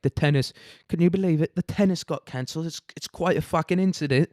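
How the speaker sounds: mock British accent. Ivy League writer aghast at cancelled tennis, mock British accent. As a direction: put on an appalled upper-crust British accent. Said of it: "I chose to do a British accent".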